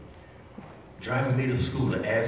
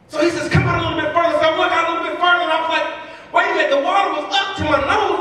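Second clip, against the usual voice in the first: louder, higher, driving preacher build; fired-up preaching; 14 semitones higher, 4 dB louder than usual. Acted. Speaker to school staff, retelling his mentor lesson, preaching loudly. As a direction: preach it to the back row, full force. Preaching the beach story at full force.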